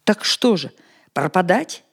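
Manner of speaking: sudden angry outburst; angry near-shout. A narrator's reading. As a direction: snap it out in anger. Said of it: "Вдруг озлясь, едва не вскрикнул".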